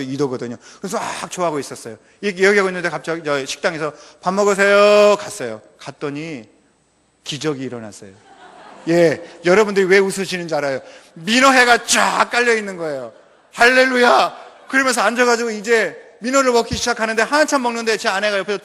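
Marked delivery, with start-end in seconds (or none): 4.2-5.2 loud call to come eat; host calling the guests to the table, loudly. Retold or imitated. 13.5-14.4 loud, high Hallelujah; thrilled preacher shouting praise; joyful shout. Real, not acted. Joy at the fish served.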